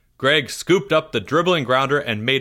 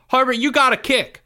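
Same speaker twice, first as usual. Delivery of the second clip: high, loud pleading call; urgent shout; 12 semitones higher, 4 dB louder than usual. Acted. Teammate shouting at the distracted batter. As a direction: urge him on fast and loud. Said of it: "said Pickle".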